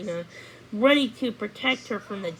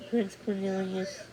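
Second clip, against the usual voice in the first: ordinary delivery, nothing marked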